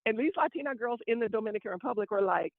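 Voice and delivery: ordinary talk, nothing marked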